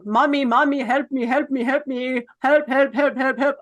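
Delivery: imitating a grandmother's repeated calls for help; mock yelling. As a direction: nag for help over and over, lightly hammed-up. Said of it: "yelling all day".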